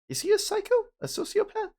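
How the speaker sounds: very high squeaky disbelief; gossiping student shocked by the news. Acted. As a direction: gasp it out scandalised.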